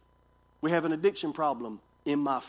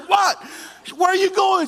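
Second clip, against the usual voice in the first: much higher, louder voice of a startled wife; 13 semitones higher, 7 dB louder than usual. Retold or imitated. Pastor voices his wife demanding where he's going, high and loud. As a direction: ask it in shock, voice jumping up. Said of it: "She said".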